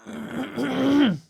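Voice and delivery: loud sharp throat clearing; a loud rough throat clear. Real, not acted. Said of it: "sorry".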